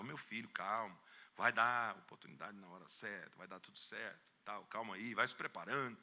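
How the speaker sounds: soft, calming fatherly voice; patient quiet reassurance; soothing pastor. Retold or imitated. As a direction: settle the eager boy down gently. Pastor soothing eager youth.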